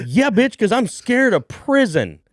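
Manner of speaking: high, loud, slow jokey yell; mock shout. Answering the dealer about the thrown-away shrooms, loud mock yell. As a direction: bellow it back with a laugh in it.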